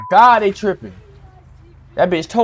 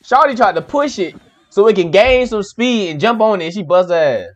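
usual speaking voice

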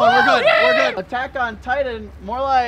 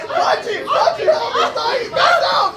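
frantic shouting; 11 semitones higher, 5 dB louder, slower than usual. Acted. Frantic scene around friend.

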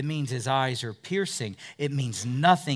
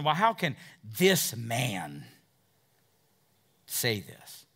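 slow, lower, dismissive "this man"; derogatory muttering; 5 semitones lower, slower than usual. Retold or imitated. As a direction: drag out "this man" with contempt. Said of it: "you can picture him going".

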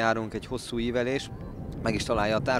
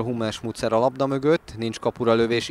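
ordinary talk

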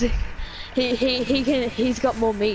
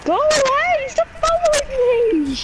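panicked shriek. Real, not acted. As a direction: shriek as it chases you. Chased by the monster.

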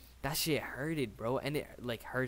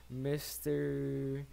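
ordinary talk

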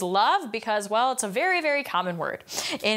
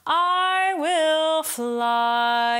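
"fly" held long and released on a breathy end; sung line with a held "fly" and a breathy release; breathy offset; 6 semitones higher, 4 dB louder, much slower than usual. Shown as a demonstration. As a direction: hang on to "fly", then let it go on air. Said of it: "a breathy offset".

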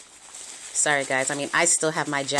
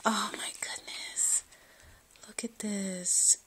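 breathless hushed whisper; awed whisper; 3 semitones higher, 9 dB quieter, slower than usual. Real, not acted. Vlogger gazing at the gift, hushed awed whisper. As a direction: whisper it in wonder.

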